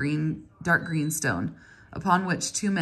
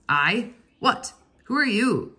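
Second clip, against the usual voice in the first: loud, sharp, higher snap of a foreman; impatient snap; 6 semitones higher, 5 dB louder, slower than usual. A narrator's reading. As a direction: bark it out, irritated. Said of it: "snapped Pi".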